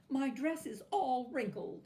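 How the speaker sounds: high, loud complaint; fault-finding little tyrant; scolding doll. A narrator's reading. Doll scolding Natasha about the wrinkled dress.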